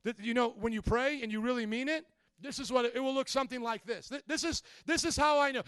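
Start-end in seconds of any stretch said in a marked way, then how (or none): none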